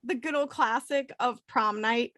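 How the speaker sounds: ordinary talk, nothing marked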